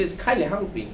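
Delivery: "kindly" with an aspirated k; British pronunciation. Retold or imitated. Teacher imitates the British saying it, "kindly" with aspirated k. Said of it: "ব্রিটিশরা তো আর কাইন্ডলি বলবে না".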